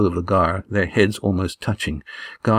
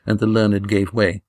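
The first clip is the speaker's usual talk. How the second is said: plain reading voice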